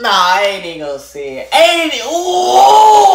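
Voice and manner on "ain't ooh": high, loud drawn-out "ooh"; worked-up holding back. Real, not acted. Holding back about the girl, wailing it loudly. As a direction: cut yourself off with a loud wail. Biting back what he would say.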